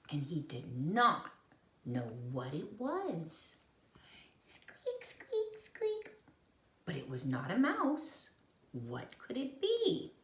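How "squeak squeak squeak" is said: high squeaky squeaks; squeak sound. A narrator's reading. Narrator imitates the mystery squeak for children. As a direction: squeak it like a toy.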